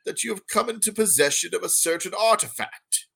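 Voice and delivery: put-on a prim old gnome merchant, unhurried. GM voicing the gnome.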